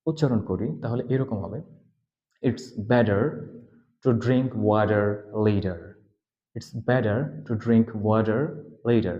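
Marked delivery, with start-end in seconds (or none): 2.4-5.8 slow American model, better, water, later with D-like T; American flapped T and sounded R, word by word; American accent. Shown as a demonstration. Teacher demonstrating the sentence in American accent. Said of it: "আমরা যখন American উচ্চারণ করব". 6.6-9.2 slow American reading, "beder, wader, leder"; American accent. Shown as a demonstration. Teacher repeating the model sentence in American accent. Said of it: "আমরা যখন American উচ্চারণ করব".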